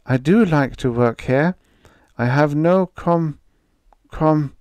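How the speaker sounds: put-on halting, unsure young girl catching on a word; a girl stuck on a word she doesn't know; stumbling over a word. A narrator's reading. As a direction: trip on the syllable and restart. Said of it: "she stumbled over the unfamiliar word".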